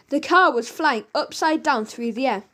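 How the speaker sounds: usual speaking voice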